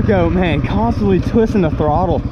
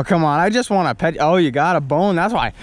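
normal talking voice

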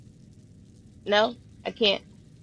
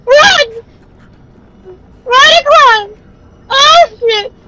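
panicked yelling; 8 semitones higher, slower than usual. Real, not acted. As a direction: yell to run, real fear. Chased again by monster.

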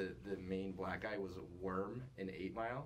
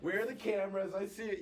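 high, loud mock paranoid voice; hammed-up Truman Show believer hunting cameras; paranoid crazy person; 9 semitones higher, 6 dB louder than usual. Friend mocks a Truman Show believer, high and loud. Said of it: "You're just a fucking crazy person".

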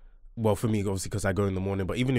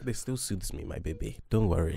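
quiet tender tease; soft reassuring; 8 dB quieter than usual. Real, not acted. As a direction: soothe her softly.

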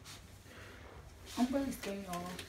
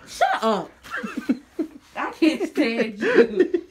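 laughing protest; 3 semitones higher, 5 dB louder, much slower than usual. Real, not acted. Laughing back at her friend's teasing. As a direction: giggle all through it.

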